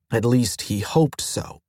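usual speaking voice

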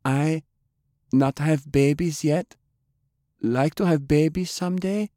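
high, small halting voice; tiny voice. A narrator's reading. Small woman speaks up from the back of the room, tiny and timid. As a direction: speak up timidly in broken English. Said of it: "a tiny voice from the back".

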